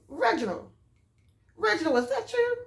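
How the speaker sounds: high-pitched imitated old lady's call; retold grandmother calling out. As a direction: call the name high, trying to catch someone's attention. Old lady calling to her grandson.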